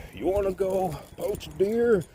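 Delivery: put-on high, loud drunk roughneck caricature; neighbor impression. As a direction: imitate the impaired neighbor proposing a scheme. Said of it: "he used to talk like this".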